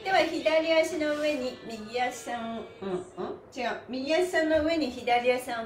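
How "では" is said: normal talking voice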